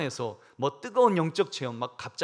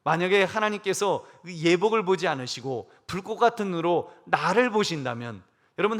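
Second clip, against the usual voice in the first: usual speaking voice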